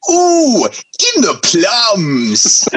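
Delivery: high, slow, drawn-out mock posh voice; Jeremy Clarkson impression. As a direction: ham up a Jeremy Clarkson quip. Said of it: "Jeremy turns to him and goes".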